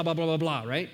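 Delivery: normal talking voice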